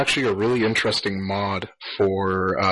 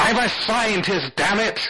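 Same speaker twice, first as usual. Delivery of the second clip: high, drawn-out mock protest; hammed-up indignant scientist; 9 semitones higher, slower than usual. Scientist protesting in the talking console bit, hammed-up indignation.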